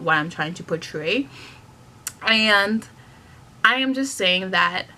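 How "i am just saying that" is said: usual speaking voice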